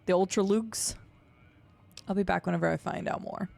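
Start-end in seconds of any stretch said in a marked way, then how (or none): 0.0-1.0 Lux said as "Luke's" with a long oo sound; rising question on "Ultra Luke's", Lux as "Luke's"; Luke's pronunciation. Pretend. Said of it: "Don't you mean Luke's?".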